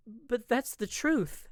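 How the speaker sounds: high, loud and slow, nervously insisting; young wife nervously insisting on propriety. Acted. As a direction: push back with anxious force. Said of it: "I nervously raised my voice".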